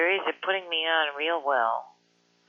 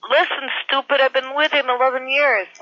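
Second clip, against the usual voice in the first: much higher and louder, furious shout; angry shout; 10 semitones higher, 8 dB louder than usual. Real, not acted. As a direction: yell at the caller in real anger. Angry man yells at prank caller.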